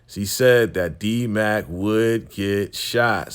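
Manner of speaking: each word drawn out; slow emphatic reveal. Real, not acted. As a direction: spell out the prediction slowly. Shout-out to viewer's prediction.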